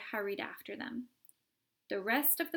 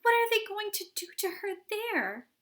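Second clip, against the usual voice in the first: high, loud frantic question; 11 semitones higher, 6 dB louder than usual. A narrator's reading. Mother frantically asking Mr Wonka about her daughter. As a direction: plead in panic. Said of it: "cried Missus Beauregard".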